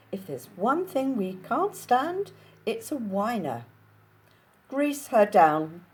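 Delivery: high, loud fussy Capitol voice; Capitol accent. Acted. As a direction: flounce through it in a Capitol accent. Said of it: "the ends of their sentences go up".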